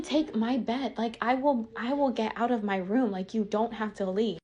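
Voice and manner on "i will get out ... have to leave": normal talking voice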